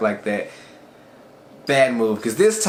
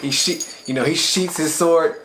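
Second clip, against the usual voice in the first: usual speaking voice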